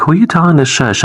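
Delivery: quick, high-pitched indignant comeback; indignant retort. Retold or imitated. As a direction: fire back in mock offense. Parents' indignant retort to child.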